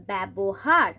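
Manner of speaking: ব্যবহার with an open æ after b, byabohar; ja-phala as æ. Shown as a demonstration. Teacher showing ব্যবহার to students: æ vowel. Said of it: "অ্যা এর মতো উচ্চারণ".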